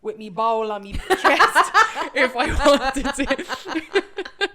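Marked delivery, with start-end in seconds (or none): 2.1-4.6 laughing, words stretched and squeezed out; laughing at her own first-class fantasy. Real, not acted. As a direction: giggle through it, barely able to finish. Giggling through the daydream.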